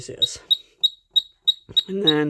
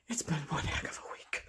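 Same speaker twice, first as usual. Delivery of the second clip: whisper; 12 dB quieter than usual. Real, not acted. Aside to viewer, whispered.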